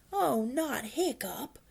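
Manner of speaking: high, slow groan of dismay; acted groan of protest. A narrator's reading. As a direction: groan it out, drawn long. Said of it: "groaned Dog's Breath the Dubrain".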